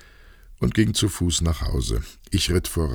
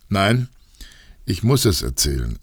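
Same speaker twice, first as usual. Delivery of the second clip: plain reading voice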